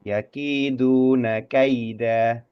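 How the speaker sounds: yakiduna kaida read slowly, a and i apart. Shown as a demonstration. Teacher modelling the verse ending slowly. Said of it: "Jadi bacanya kai".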